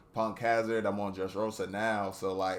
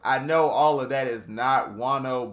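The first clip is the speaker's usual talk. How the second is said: excited; 4 semitones higher, 8 dB louder than usual. Real, not acted. Excited fan outburst.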